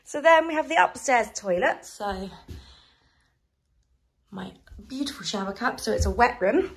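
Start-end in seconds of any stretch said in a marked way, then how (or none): none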